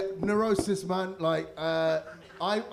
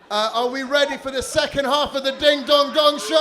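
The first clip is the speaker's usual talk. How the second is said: loud, high stage intro; announcer voice; 8 semitones higher, 9 dB louder than usual. Real, not acted. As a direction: hype the crowd for the act. Host introducing next act.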